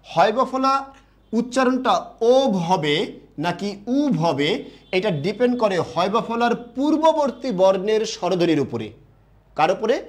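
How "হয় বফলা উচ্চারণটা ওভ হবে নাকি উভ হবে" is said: high, slow, spelling the rule out; slow, raised teacher voice; slow teaching emphasis. Shown as a demonstration. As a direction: stretch each word so the class follows. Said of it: "একটু খেয়াল করবেন".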